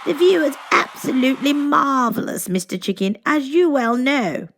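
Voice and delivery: lightly voiced gracious queen; a hint of royal poise; Queen again. A narrator's reading. Queen speaking on balcony.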